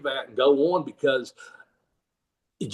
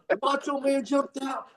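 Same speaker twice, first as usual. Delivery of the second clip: high, softer retold excitement; excited fan; 7 semitones higher, 8 dB quieter than usual. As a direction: imitate a fan reliving the moment.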